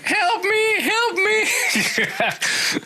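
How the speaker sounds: high-pitched squealed plea for help; mock screaming kid. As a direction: imitate a screaming child, very high, playfully. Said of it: "were you the kid screaming".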